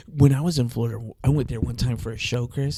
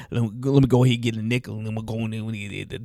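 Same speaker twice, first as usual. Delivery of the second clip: Louisiana accent impression; 3 semitones lower, faster than usual. Imitating how Louisiana people talk, fast Louisiana accent. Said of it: "they talk like this here".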